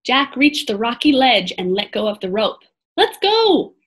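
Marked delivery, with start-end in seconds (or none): 3.0-3.7 high-pitched call from a distance. A narrator's reading. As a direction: call them to get moving. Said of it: "Annie called from the treehouse".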